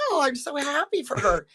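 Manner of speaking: bright, high put-on congratulation; fake gracious. Losing nominee faking joy for the winner. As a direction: force out a polite cheer through disappointment. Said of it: "you have to go".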